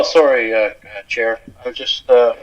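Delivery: usual speaking voice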